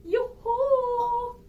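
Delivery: loud high sing-song yoo-hoo; calling out. Acted. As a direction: call across the stage. Calling husband offstage.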